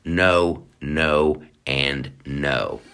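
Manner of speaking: ordinary delivery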